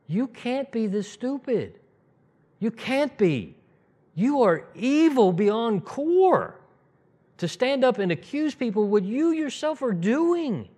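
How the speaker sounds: high, slow, incredulous scolding; exasperated outburst. Retold or imitated. Preacher mocking political liars, exasperated and high. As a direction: lay into them in disbelief. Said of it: "I just go".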